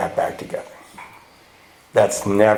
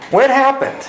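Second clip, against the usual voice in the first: mock-bewildered, much higher; a confused wake-up cry, pitched way up; 14 semitones higher than usual. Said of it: "You're looking around like".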